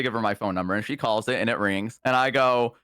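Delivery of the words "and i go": normal talking voice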